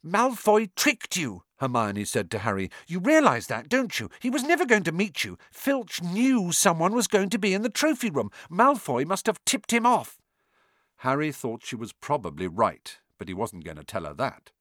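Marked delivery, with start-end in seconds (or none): 0.0-10.2 high, know-it-all schoolgirl; bossy girl voice. A narrator's reading. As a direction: lecture him like a clever girl.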